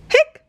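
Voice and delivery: sharp high hiccup sound; a little squeaky hic. Acted. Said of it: "said Grandpa Chan".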